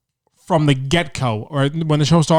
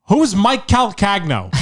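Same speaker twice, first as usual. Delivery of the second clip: slow, higher, shouted demand; imitating a shout; 6 semitones higher, slower than usual. Host storming into the green room demanding the comic, shouted. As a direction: bark out the name like you're hunting him.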